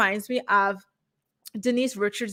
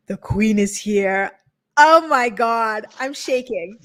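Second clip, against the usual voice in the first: slow, drawn-out gushing welcome; starstruck greeting; 6 dB louder, slower than usual. Real, not acted. Host welcomes co-host as the queen, starstruck. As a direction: stretch each word out in thrilled awe.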